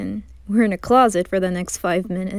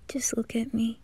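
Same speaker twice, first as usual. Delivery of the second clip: whisper; 8 dB quieter than usual. Acted. Intimate moment in closet.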